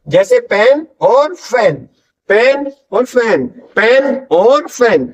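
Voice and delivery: "पेन" popped from the lips and "फैन" hissed through the teeth, slowly; teacher contrasting "पेन और फैन" slowly. Shown as a demonstration. Teacher drilling students on "पेन और फैन", slow and careful. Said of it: "पी दोनों होठों से ... एफ".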